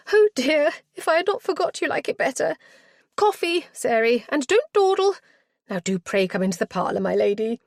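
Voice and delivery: put-on high, fluttering, flustered old matron. Matron greeting her lady visitor, high and flustered. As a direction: gush over the guest in a high flustered voice. Matron chuckling, ordering coffee.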